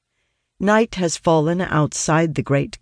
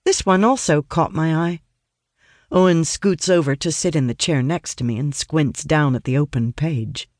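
usual speaking voice

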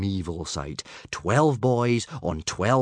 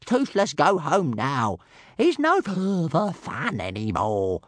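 slow whiny dragon moan; tired little dragon whining; complaining; 6 semitones higher, slower than usual. Acted. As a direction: grumble from under the helmet. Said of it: "a constant stream of echoey complaints".

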